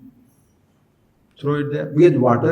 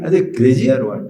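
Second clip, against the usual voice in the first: ordinary delivery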